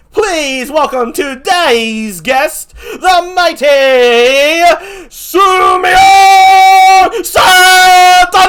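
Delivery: wrestling-ring announcer hyping the guest to the rafters; hype announcer intro. Acted. Talk show host introduces his guest.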